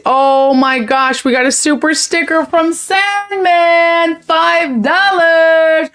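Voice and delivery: high, slow delighted gush; thrilled. Real, not acted. Thrilled by a super sticker.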